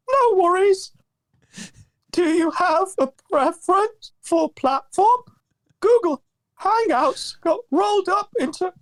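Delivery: high, thin put-on shaky voice; shaky Josh voice. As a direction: read it as a wavering, fragile Josh. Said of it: "you do a better shaky Josh voice".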